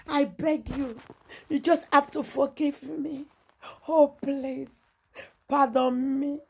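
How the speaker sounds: mock tearful pleading, high-pitched with gasps between phrases; begging for forgiveness. Vlogger mimics a man begging to be forgiven, high mock pleading. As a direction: beg pitifully in a high, whimpering imitation. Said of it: "he dey beg for forgiveness".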